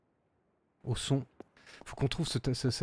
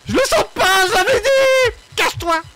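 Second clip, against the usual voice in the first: panicked yelling; 8 dB louder than usual. Real, not acted. Monster near, panics, tells to hide.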